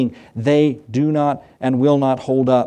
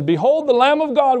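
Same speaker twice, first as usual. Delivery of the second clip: proclaiming; 11 semitones higher than usual. Retold or imitated. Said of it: "he points his finger and says".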